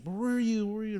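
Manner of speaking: high, loud accusing shout of where were you; loud high mock demand from the wife; wife's high shout. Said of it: "She's like".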